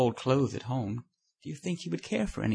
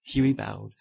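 ordinary delivery, nothing marked

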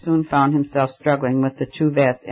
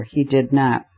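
usual speaking voice

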